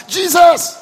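loud, high cry of a name. Retold or imitated. Blind beggar crying out to Jesus past the crowd, loud. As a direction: call out desperately over the crowd. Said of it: "the more he cried".

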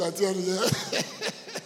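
long amused laugh. Real, not acted. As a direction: laugh at your own example. Laughs at own example.

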